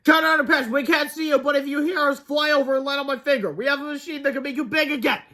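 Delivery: high, loud call into the air; calling loudly. A narrator's reading. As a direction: call out to someone unseen. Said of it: "called as loud as he can".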